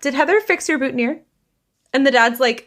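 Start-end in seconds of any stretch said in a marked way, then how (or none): 0.0-1.2 pointed question. Retold or imitated. Deb's pointed question to dad.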